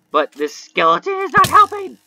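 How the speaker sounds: high, loud yell of frustration; exasperated yell. Real, not acted. Gamer trying to focus under skeleton attack, exasperated yell. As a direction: yell it, pitch shooting right up.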